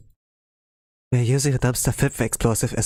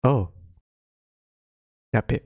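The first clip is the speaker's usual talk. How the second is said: ordinary talk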